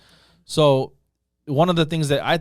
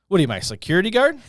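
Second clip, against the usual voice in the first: mock gruff retort, higher and louder; lightly voiced grumpy Hall of Famer scoffing; grumpy ballplayer; 7 semitones higher, 5 dB louder than usual. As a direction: jab at the kid like a cranky old star. Grumpy star mocks the teen helper.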